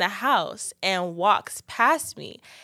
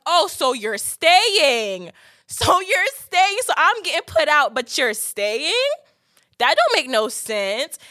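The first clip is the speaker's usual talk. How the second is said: indignant protest; 6 semitones higher, 4 dB louder, slower than usual. Retold or imitated. Protesting being put out.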